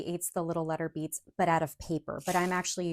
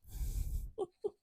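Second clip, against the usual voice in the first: airy relieved laugh; 14 semitones higher, 4 dB quieter than usual. Real, not acted. Relieved after careful embossing.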